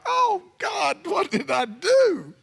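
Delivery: high, slow panicked wail of regret; mock panic. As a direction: cry out in sudden buyer's remorse. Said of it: "you're like".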